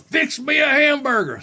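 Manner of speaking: high, hollered demand; grandfather yelling. Retold or imitated. Grandfather orders food from bed, imitated high yell. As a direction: imitate a drunk calling out his order. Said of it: "yelling out".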